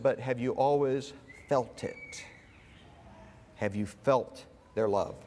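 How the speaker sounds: low, slow retold question, gently put; probing therapist. Therapist questioning his client about feeling loved, probing.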